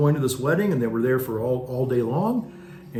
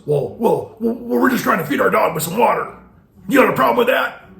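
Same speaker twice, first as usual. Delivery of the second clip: loud, high indignant challenge; mocking an angry driver, much louder and higher; angry man's retort; 10 semitones higher, 7 dB louder than usual. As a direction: bark it back at him like a riled-up driver. Angry driver confronts him.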